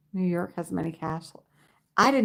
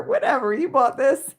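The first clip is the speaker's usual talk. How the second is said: teasing; 8 semitones higher, 6 dB louder than usual. Real, not acted. Teasing reply to chat.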